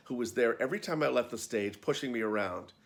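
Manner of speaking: ordinary delivery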